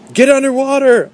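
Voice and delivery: very high, urgent command; high-pitched sustained holler; shout. Acted. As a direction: shout a warning to everyone around. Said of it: "I shouted".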